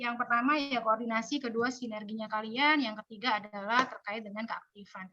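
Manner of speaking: daftar poin datar, makin rendah dan pelan; contoh monoton. Shown as a demonstration. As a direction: bacakan poin tanpa naik turun. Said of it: "Jangan monoton kalau ngasih". (penyampaian lesu tanpa dinamika)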